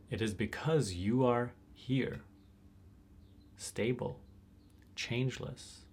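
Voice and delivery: slow, deliberate pointing with weighted key words; measured meditation teacher, long pauses; guided meditation. Real, not acted. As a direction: space out the phrases, leaning on each key word.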